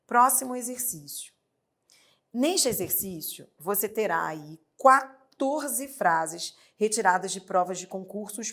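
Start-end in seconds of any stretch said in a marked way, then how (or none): none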